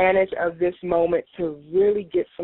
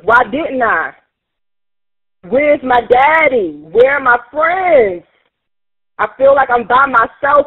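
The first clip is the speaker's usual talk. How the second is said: pained outcry; 4 semitones higher, 4 dB louder than usual. Acted. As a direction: cry out the grief. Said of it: "I'm gonna express the pain".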